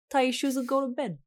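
attempted sign-off impression; Jake Baldino. Host filling in for absent co-host, attempted impression. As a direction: do your best go at a co-host's catchphrase. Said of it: "I'm gonna do my best impression".

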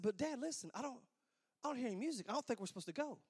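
soft, pleading objection; hushed protest. Retold or imitated. Groom arguing with his dad at the side door, hushed protest. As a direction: argue back in an undertone.

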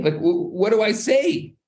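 high, stammered question; stumble, then let the pitch shoot up; acted helplessness. Prince at a loss for words to God, acted helplessness.

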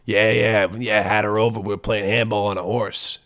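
a sly put-on voice of a guy bragging; hammed-up deviant aside; mock lecher voice. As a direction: voice the creep, lightly.

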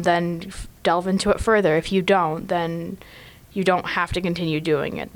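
ordinary talk

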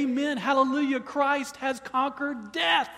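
loud, high-pitched call of praise; proclaiming aloud. Shown as a demonstration. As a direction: lift the congregation into a shouted amen. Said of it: "we should say".

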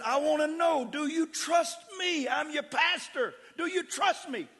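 high, loud, slow demand; insistent shout. Retold or imitated. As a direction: hammer the question home. Pastor shouting at drowning man.

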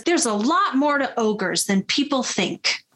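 usual speaking voice

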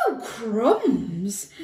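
loud, mildly alarmed gulp; a touch of dread, as a storybook elephant. A narrator's reading. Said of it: "gulped the elephant".

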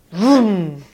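playful revving engine noise; vroom vroom engine. Retold or imitated. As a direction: rev it like a car engine. Hit the throttle, engine noise.

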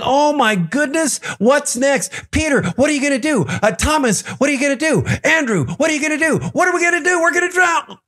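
mounting high-pitched mock panic; freaking out. Frightened disciples asking each other what to do, freaking out. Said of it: "They are freaking out".

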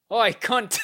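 imitated British heckle; Niles. Englishman calling out to hippie woman, loud mock-British accent. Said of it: "Niles is like".

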